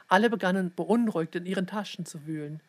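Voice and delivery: plain reading voice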